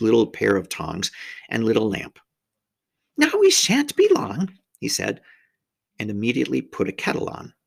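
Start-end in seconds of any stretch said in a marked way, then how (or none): 3.2-4.6 high-pitched faun character voice; cheery host, pitched way up; hospitable faun. A narrator's reading. Faun reassuring his guest about tea, high and obliging.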